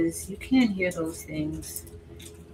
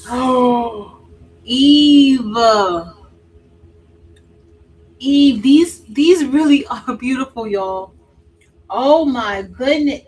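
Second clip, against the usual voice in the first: excited; 4 semitones higher, 10 dB louder, slower than usual. Real, not acted. Opening new palette, thrilled.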